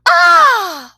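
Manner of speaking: high, loud delighted ah; surprised ah. Acted. Girls seeing the toys and candies, high delighted ah. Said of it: "and then said".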